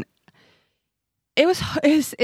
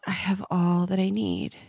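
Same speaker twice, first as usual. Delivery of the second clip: softer, let it out on the out-breath; breath prayer on the exhale; 5 dB quieter than usual. Shown as a demonstration. Mother shows the host her breath prayer, softly on the exhale. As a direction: release the words as you exhale. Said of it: "And then I breathed out".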